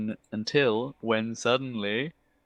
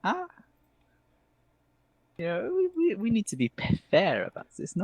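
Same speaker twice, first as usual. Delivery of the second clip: very high, fussy elf voice; high-pitched, dithering elf; theatrical elf, hesitant; 4 dB louder than usual. Acted. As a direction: hem and haw in a high character voice. Game master rules in elf voice.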